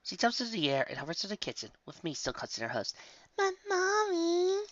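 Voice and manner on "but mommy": put-on high, drawn-out child's whine; whining. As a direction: whine it slowly like a pleading kid. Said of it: "I whined".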